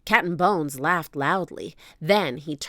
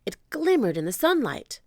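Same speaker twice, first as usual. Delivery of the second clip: usual speaking voice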